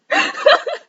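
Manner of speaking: squeaky high-pitched giggle burst. Real, not acted. Looking at herself in the mirror, squeaky high giggle. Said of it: "I can't stop giggling".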